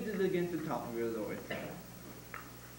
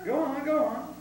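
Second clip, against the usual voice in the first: louder, higher urging; 8 semitones higher, 7 dB louder than usual. Real, not acted. Teacher urging pupil to keep on. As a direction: push her to keep going.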